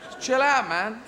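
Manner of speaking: high, lazy and unbothered; like a lounging slacker; sleepy lazy voice. Pretend. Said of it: "Another eye is going".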